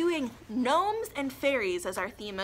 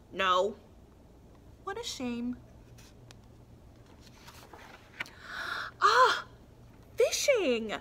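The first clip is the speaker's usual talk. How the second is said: lively storybook voice with figures; reading to children; 4 semitones higher, 3 dB louder than usual. A narrator's reading. Teacher reads a picture book to young children, lively. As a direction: read it bright for little ones.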